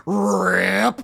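loud, drawn-out fabric tear noise; vocal sound effect of a coat tearing; imitated ripping noise. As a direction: imitate cloth ripping, loudly. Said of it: "leaning over and hearing".

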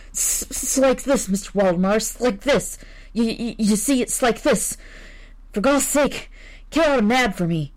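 a frightened man begging, tripping on his words; terrified visitor. Acted. Terrified visitor stammering to editor.

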